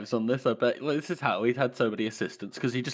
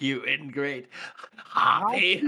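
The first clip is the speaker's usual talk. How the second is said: imitating Morvir's insult; 2 semitones higher, much slower than usual. Fan quoting the poisoner insulting his assistant, hammy sneer. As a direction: hurl a theatrical insult. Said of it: "comes out with some of the best insults".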